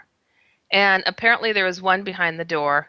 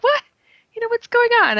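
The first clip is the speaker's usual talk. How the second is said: high, loud startled outburst; 4 dB louder, faster than usual. Retold or imitated. Woman finding aliens in her kitchen, startled high cry. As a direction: jump in pitch, caught off guard.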